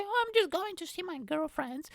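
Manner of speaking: put-on high girly voice. Girl excusing herself to see friends, high girly voice. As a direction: chirp like a girl leaving. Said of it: "girl can say".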